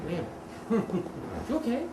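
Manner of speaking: soft sympathetic wince with a laugh; imitating a wince. Noticing a sick person from afar, a soft wincing sympathy. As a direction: wince at someone who looks sick, then ask. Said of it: "thirty feet away and go".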